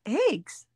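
eggs said short and clean, in her own way; demoing eggs. Shown as a demonstration. Answering a friend on how to say eggs, in her own way. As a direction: show how eggs should sound. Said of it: "how are you supposed to say eggs?".

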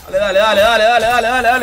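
loud, rising chant of dale; urging on. Real, not acted. Streamer urging a player on mid-fight, loud chant.

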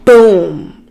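sudden loud boom, imitating a thud. Storyteller imitates a thud heard over the phone, loud. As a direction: hit it hard and drop, like a thud. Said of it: "I hear this loud thud".

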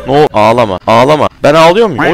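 loud, rattled yelling at the game; panicked shout. Real, not acted. Gamer spooked by a monster, shouting in panic. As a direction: yell at the screen in a real panic.

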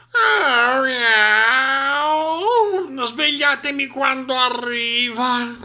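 high, slow yawning cat; sleepy cat yawn. A narrator's reading. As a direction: yawn it out lazily. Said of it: "mormorò". (Gatta che sbadiglia e mormora)

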